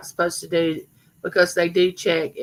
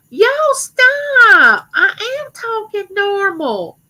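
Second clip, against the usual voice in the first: very high, wailing cousin impression, loud at first; Sandy's high voice; 13 semitones higher, 6 dB louder, much slower than usual. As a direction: imitate a cousin's drawn-out, high-pitched protest. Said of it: "And Sandy talked like this".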